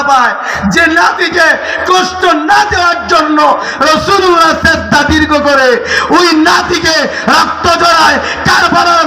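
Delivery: high, impassioned sermon cry; fiery Karbala preaching; preaching at full force. Acted. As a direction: rouse the crowd with rising fervour.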